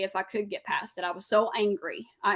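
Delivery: ordinary delivery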